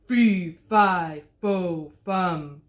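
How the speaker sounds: loud booming giant, lightly voiced; giant bawling. A narrator's reading. A giant bawling at the wolf, booming. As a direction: bellow each word like a giant. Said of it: "horrible giant voice bawling".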